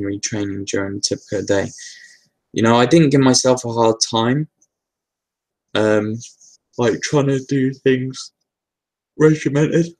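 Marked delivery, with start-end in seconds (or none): 5.7-9.9 real yawn stretching the words, slow and higher; yawning while talking. Real, not acted. Answering a viewer's question about meditation routine, yawning. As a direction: talk through a yawn, drawing it out. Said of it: "Don't know why I'm yawning".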